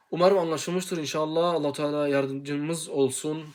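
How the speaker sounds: normal talking voice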